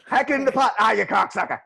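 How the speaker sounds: high, loud mock Boston yell; rowdy local. Locals missing the pot, high loud mock yelling. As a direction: cheer and curse a missed toss. Acting locals tossing lobster.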